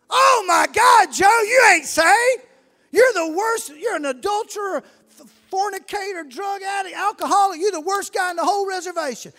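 loud, high, indignant telling-off; scolding shout. Retold or imitated. Church members berating Joe at the altar, shouting. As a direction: scold him like the worst sinner.

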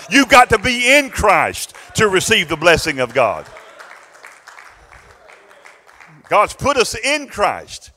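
loud, high, driving preacher delivery; full-force preaching. Real, not acted. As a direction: preach it out at full volume, building in fervor.

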